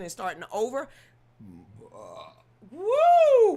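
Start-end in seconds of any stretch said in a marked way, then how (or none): 2.7-3.6 high, loud burp let out as a whoop. Real, not acted. On a livestream, releasing a long-held burp, loud and high. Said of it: "I was holding on to that burp".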